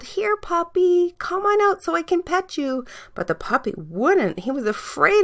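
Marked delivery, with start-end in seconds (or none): 0.0-2.8 put-on little girl calling. A narrator's reading. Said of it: "The girl called".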